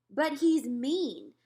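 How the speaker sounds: slow, loud, high complaint; whiny objection; protesting. A narrator's reading. Girl protesting about the boy, whiny. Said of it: "protested the girl".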